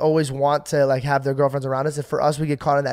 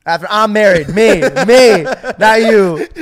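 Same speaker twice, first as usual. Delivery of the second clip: loud, laughing tease; laughing loudly; 6 semitones higher, 11 dB louder, slower than usual. Real, not acted. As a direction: laugh it out at your friend. Laughing teasing the co-host.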